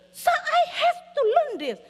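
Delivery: put-on high, loud, drawn-out kid's protest; slow, insistent high whine of protest; protesting child. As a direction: imitate a child arguing back. Child protests to parent, retold.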